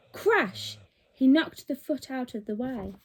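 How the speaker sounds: ordinary delivery, nothing marked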